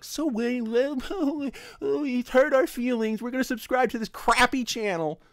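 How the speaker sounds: high, sulky mock-hurt voice; mock whiny commenters. Mocking commenters who subscribed out of spite, high sulky voice. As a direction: parody the commenters' hurt feelings. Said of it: "are just like".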